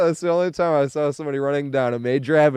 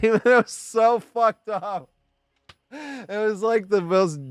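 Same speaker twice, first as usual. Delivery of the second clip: words squeezed out through laughter, higher and slower; can't stop laughing while saying it; 8 semitones higher, slower than usual. Real, not acted. Laughing while retelling trombone.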